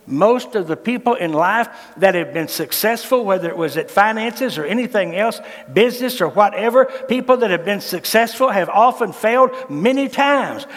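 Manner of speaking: usual speaking voice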